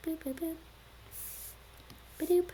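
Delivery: tiny, high, soft boop sounds; little cartoon button noises; soft squeaky sound effects; playful booping. Imitated noises while fixing the screen.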